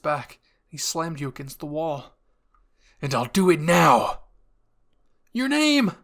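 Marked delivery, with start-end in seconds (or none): 3.0-4.2 much louder, attacking shout; furious yell. A narrator's reading. Reiner pinning you to the wall, furious yell. 5.3-5.9 high, loud desperate scream. A narrator's reading. Armin screaming your name as Reiner strikes. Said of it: "Armin screamed".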